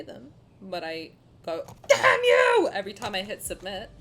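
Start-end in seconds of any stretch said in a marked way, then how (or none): 1.9-2.7 high, loud curse; mock cursing shout. Acts cursing when paying taxes.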